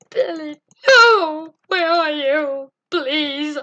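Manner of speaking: loud, slow pleading yell; mock desperate shout. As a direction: call out in hammed-up panic. Calling lost friend in game.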